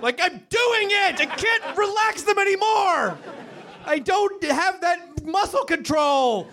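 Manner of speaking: high-pitched, worked-up yelling; exasperated. Acted. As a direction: protest at a high pitch, drawing out the words. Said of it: "it fucking makes me so mad".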